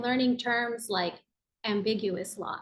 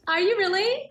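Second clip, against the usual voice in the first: high, loud burst of real surprise; delighted surprise; 11 semitones higher, 7 dB louder than usual. Real, not acted. Guest learns the host is also the youngest, delighted surprise. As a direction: gasp it out, thrilled to find common ground.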